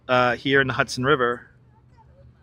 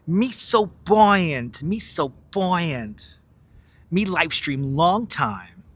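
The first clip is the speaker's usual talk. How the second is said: very slow, drawn-out put-on voice; movie character imitation; 3 semitones higher, much slower than usual. Streamer saying it like the movie, slow character imitation. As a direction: stretch each word in a put-on movie voice. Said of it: "I'll say it like the movie, though".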